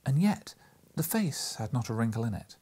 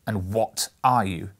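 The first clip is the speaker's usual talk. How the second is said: louder and slow, a firm demand; 7 dB louder, slower than usual. Acted. Scrooge to the strange spirit at his bed, demanding. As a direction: insist on an answer. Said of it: "demanded Scrooge".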